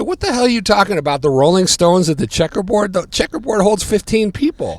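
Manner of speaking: high, loud, fast disbelief; incredulous rant. Retold or imitated. Fan scoffing at Stones-at-Checkerboard news, loud and incredulous. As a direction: scoff at the crazy claim.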